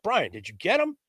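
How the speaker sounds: high-pitched imitation of calling a partner; mock calling over the walkie-talkie; retold calling out. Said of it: "I'm calling".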